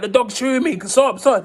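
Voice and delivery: high-pitched, re-enacted cry for help; panicked pleading. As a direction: plead frantically as if under attack. Begging police to stop dog.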